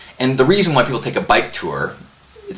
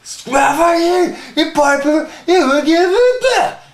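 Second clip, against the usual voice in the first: put-on drunk group yelling; 5 dB louder than usual. Twelve drunk riders yelling at the neighbor, wailing mock shout. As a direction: bellow it like a drunken mob. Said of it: "starts yelling".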